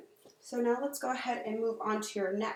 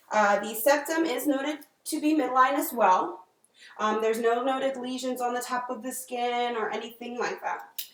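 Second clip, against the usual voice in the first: clinical dictation; 5 dB louder than usual. Acted. Dictating exam findings aloud.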